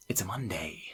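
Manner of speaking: soft whispered quote. Retold or imitated. Friend mimicking the storyteller's line, in a whisper. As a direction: whisper it flatly, barely voiced. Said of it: "Just like that".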